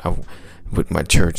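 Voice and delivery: ordinary delivery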